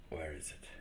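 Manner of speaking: unvoiced whisper to himself. Real, not acted. Streamer searching his settings, whispering to himself. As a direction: mutter it to yourself while hunting for the button.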